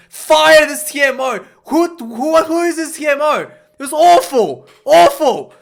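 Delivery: real exasperation building to a near shout; angry outburst. Real, not acted. Rugby fan fuming at the TMO's call, angry loud outburst.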